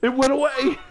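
high, startled cry; surprised outburst. Real, not acted. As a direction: blurt it out in disbelief. Surprise at vanishing object.

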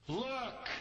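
high, scornful bark of look; disdainful look. Acted. Son snubbing his father, disdainful. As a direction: throw the word with contempt.

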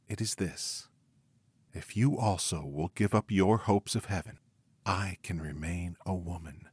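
plain reading voice

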